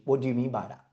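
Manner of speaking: quiet, gently probing; understated soft probing question; soft curious question. Shown as a demonstration. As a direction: ask it softly, with real curiosity. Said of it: "this is probably my favorite question".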